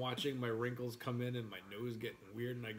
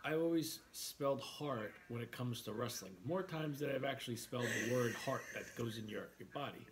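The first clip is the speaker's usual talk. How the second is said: normal talking voice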